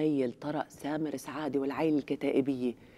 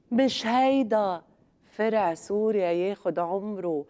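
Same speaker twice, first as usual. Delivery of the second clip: slow mocking question; 5 semitones higher, slower than usual.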